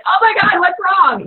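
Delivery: high, alarmed mock panic. Wife jokingly panicking over a missed butt grab, high mock alarm. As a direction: play up the worried wife's panic. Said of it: "I'm like".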